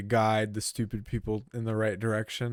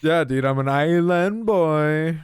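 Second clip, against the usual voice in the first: put-on loud, drawn-out island-boy accent, higher pitched; mock Islander accent; 4 semitones higher, 6 dB louder, slower than usual. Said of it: "Was that your Islander accent?".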